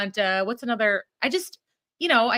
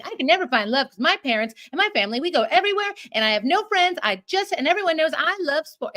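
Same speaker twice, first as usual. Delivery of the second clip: higher, whiny parody voice, rushing at first; mock Hallmark heroine; 6 semitones higher than usual. Mocking the cheesy movie's lead, higher whiny parody. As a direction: play the lonely sporty heroine as a joke, pitched up.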